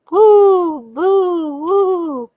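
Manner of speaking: high hooting ghost moans; ghostly woo. A narrator's reading. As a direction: moan like a spooky ghost, high and loud. Ghostly noises in the woods.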